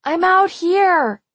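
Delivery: slow, high, loud shout; drawn-out desperate yell. A narrator's reading. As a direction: shout to be noticed from outside. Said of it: "I yelled, pounding the glass".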